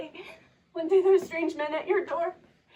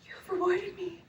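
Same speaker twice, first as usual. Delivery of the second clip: usual speaking voice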